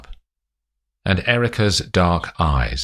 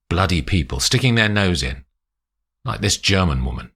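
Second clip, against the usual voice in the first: ordinary delivery, nothing marked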